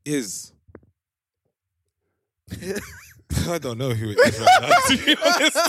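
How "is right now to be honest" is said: laughing hard mid-sentence. Real, not acted. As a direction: talk through big laughs. Host laughing at the guest.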